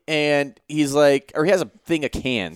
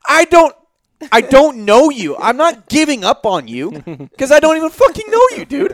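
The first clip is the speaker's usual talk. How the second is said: exasperated shout; 8 semitones higher, 5 dB louder than usual. Retold or imitated. Retorting to crying intruder.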